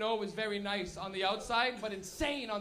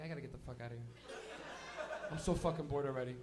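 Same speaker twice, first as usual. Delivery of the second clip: bored mumble; 11 semitones lower, 8 dB quieter than usual. Retold or imitated. Bored stoned student.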